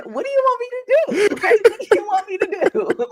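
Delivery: high, laughing mock exasperation. Host laughing at co-host's teasing, exasperated. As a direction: throw up your hands, laughing high.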